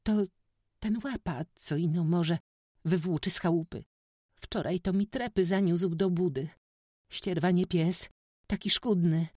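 high, plaintive woman's whine; whiny, flustered self-justification, pitched well up; whining excuse. A narrator's reading. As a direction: explain yourself in a high, moaning voice. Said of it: "zaczęła się jękliwie tłumaczyć".

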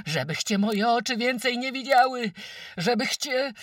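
piercingly high, loud curse; screaming her lungs out. A narrator's reading. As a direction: hold a raging scream throughout. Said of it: "tak się wydzierała". (wydzieranie się wniebogłosy)

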